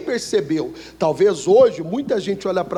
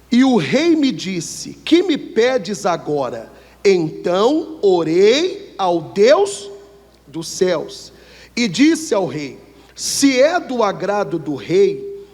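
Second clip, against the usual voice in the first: slow, deliberate Bible reading; slow Bible reading to the congregation; scripture reading; slower than usual. Acted. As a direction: read the verse out slowly and solemnly. Reads Nehemiah verse aloud.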